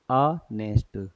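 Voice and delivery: ऑनेस्ट drawn out slowly, no h; slow model word. Shown as a demonstration. Teacher answering her own question, slow careful model. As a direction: spell out ऑनेस्ट slowly for the class, vowel first. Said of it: "क्या पढ़ेंगे?".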